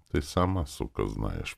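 low, soft tired aside; drained, muted inner grumble; weary thought. A narrator's reading. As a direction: think it wearily to yourself. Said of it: "подумал я устало".

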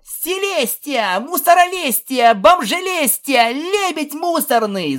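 high, drawn-out taunting shouts; yelling back. A narrator's reading. Filly hurls insulting nicknames at the princess, yelling. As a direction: shout each mocking name slowly and loudly. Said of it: "заорала в ответ Пегаска".

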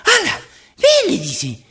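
hammed-up high wife voice; shrill alarmed wife. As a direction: yelp it like a frightened wife at night. Wife wakes husband in panic.

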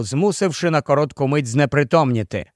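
plain reading voice

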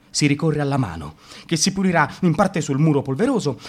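usual speaking voice

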